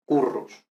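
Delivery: currus with a plain Spanish single-tap R. Shown as a demonstration. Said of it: "pronunciarlo como la R española".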